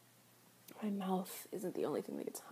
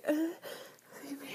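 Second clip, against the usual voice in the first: breathy whispered plea; 6 semitones higher than usual. Acted. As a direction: beg in a whisper. Begging during the act.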